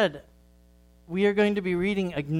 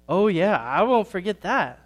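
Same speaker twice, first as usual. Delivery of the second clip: louder, eager burst; exclaiming; 3 semitones higher, 6 dB louder than usual. Acted. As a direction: blurt it out, remembering the flies. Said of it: "Sammy exclaimed".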